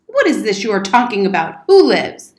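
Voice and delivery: loud, rising indignant questioning; blustering old soldier's outburst; outraged demand. Acted. Soldier outraged by boy's claim.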